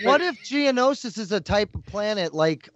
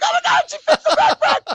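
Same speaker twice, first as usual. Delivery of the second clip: very high, loud fangirl shriek with a laugh; girl scream; 7 dB louder than usual. Retold or imitated. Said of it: "I did a total girl scream".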